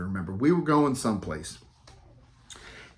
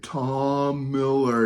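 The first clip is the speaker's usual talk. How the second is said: much slower, eerie drawn-out call; ghost voice; 3 semitones higher, much slower than usual. Pretend. Pranking Tom in the motel room, ghostly call. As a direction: call his name like a spirit.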